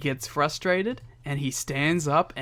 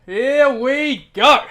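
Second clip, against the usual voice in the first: hyped cheer; 8 semitones higher, 9 dB louder, much slower than usual. Real, not acted. Hyping the promo read.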